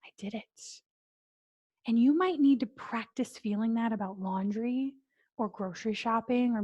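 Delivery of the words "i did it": barely voiced, softer, done at last; relieved whisper. Shown as a demonstration. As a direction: sigh it out as if finally finished. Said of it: "feel that calmness in your body".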